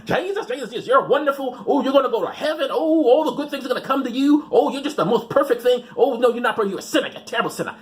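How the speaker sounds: mock televangelist. Comic pretending to be a radio preacher, gushing. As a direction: fawn over the viewer like a TV preacher. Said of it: "I could do these videos up and go like".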